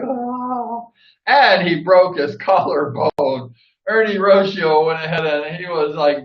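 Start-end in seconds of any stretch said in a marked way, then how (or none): 0.0-0.9 soft high moan of pain; pained groan. Retold or imitated. Ernie carried back, soft pained moan. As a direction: let out a hurt little oh. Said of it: "he's all like".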